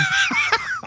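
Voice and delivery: high shrieking laugh, a sudden burst; burst of laughter. Real, not acted. Co-host jokes about asking him out, shrieking laugh.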